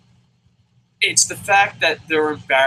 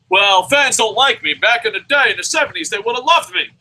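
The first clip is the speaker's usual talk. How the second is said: whiny wrestler excuse; 7 semitones higher, 6 dB louder than usual. Retold or imitated. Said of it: "use the kayfabe switch".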